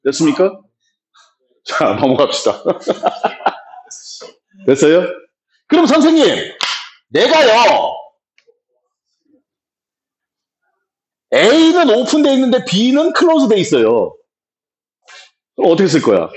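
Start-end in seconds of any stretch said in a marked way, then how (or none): 2.7-3.5 rapid, breath-only chuckle; light chuckle. Real, not acted. Ri ao mudar de assunto. 5.7-14.2 much higher voice of a questioning pupil; student asking teacher. Acted.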